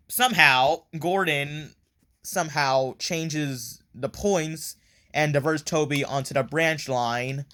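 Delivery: normal talking voice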